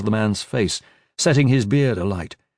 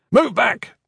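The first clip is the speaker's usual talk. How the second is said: high, forceful shout; sharp raised yell to clear the way; shouted order; 12 semitones higher, 3 dB louder than usual. A narrator's reading. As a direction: shout a command at the crowd. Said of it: "he shouted".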